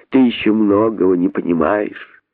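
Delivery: ordinary delivery, nothing marked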